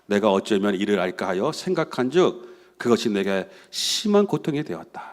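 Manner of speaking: recited low and subdued, as anguished pondering; serious, troubled. Retold or imitated. The psalmist ponders what he cannot understand, in low troubled tones. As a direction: read it as a heavy private struggle, softly. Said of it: "심각하게 시편 기자가 고민을".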